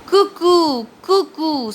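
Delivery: high, loud birdcall cuckoo; teacher voicing the bird's call for the class. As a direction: sing it out like a cuckoo on the tree. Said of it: "says the bird on the tree".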